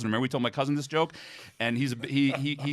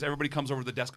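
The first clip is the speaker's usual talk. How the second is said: ordinary delivery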